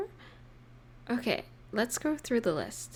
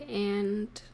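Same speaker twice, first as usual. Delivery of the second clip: ordinary talk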